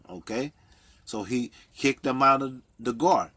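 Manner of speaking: ordinary delivery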